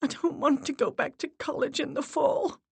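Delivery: high, tearful pleading; voice breaking. A narrator's reading. Miranda begs her dead mother, tearful and high. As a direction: plead while crying. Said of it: "Miranda's voice broke".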